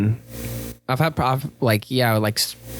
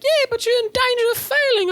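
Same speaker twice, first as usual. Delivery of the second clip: much higher prissy teacher warning; mock teacher voice. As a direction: chirp it like a fussy teacher. Teacher voiced in retold exchange.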